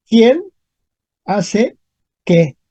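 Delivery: "¿Quién ... hace ... qué?" with clear gaps; word by word. Shown as a demonstration. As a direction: pause after "Quién" and after "hace". Teacher repeats example word by word.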